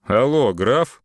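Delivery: high, loud buffoonish greeting; clowning. A narrator's reading. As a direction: play the fool on the phone. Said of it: "дурашливо сказал в трубку Самарин".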